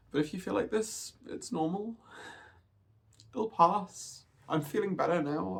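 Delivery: talking through tears, fighting to stay steady; tearful. Real, not acted. Vlogger telling viewers about a hard dysphoria day, tearful. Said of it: "I'm gonna try not to cry too much in this video".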